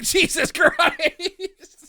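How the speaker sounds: mock horror at a man chewing gas; slow shocked exclamation. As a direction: stretch it out in disbelief. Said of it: "I'm like".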